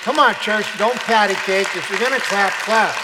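loud, playful prodding of a crowd; loud rallying call. Real, not acted. Preacher urges a half-clapping church to clap properly, loud rallying call.

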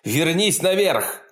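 loud, slow urgent shout. A narrator's reading. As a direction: yell it as a command. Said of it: "крикнул он".

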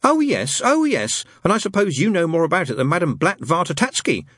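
put-on high, sarcastic boy's retort; sniffy. A narrator's reading. Boy puts down doubting friend, with a sniffy sarcasm. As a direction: start way up high and scoff. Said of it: "sniffed Adam".

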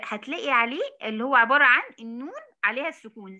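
ordinary talk, nothing marked

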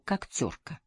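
plain reading voice

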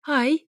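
high "ai!" yelp; squeal. A narrator's reading. Ex squeals behind the wall, high yelp. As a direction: yelp sharply, pitched well up. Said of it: "взвизгнула Тая".

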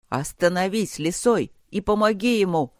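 ordinary delivery

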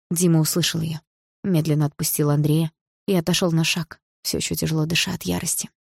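ordinary delivery, nothing marked

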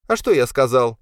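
возмущённо. A narrator's reading. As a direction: protest in a high, loud son's voice. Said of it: "возмутился Павел". (возмущённый высокий голос)

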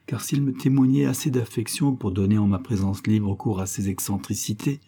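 ordinary delivery

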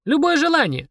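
put-on high girlish interest; little girl perking up; intrigued child. A narrator's reading. Girl tempted by the demon's offer, high intrigued voice. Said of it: "заинтересовалась Полина".

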